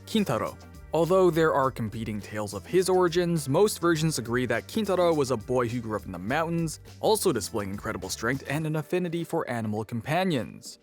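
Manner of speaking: usual speaking voice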